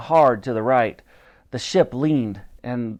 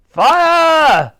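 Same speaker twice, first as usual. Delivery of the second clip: very high, loud drawn-out order; shouted command; 9 dB louder than usual. A narrator's reading. The Admiral orders the private to fire, shouting. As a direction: yell the order impatiently.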